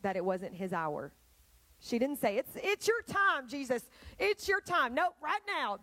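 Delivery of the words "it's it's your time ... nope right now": high, insistent mother urging her son; pushy mother. Acted. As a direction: push him like an impatient mom.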